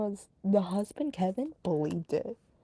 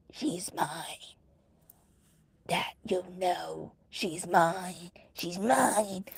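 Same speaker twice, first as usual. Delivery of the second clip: possessed voice; much slower than usual. Retold or imitated. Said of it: "deepen her voice a bit, growling".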